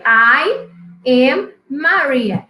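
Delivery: very slow, each word clear; slow model sentence. Shown as a demonstration. Teacher reading the model answer slowly to the class. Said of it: "Tá aí".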